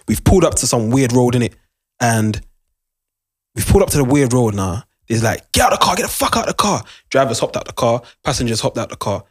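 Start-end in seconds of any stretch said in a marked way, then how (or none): 5.5-6.8 retold robber order.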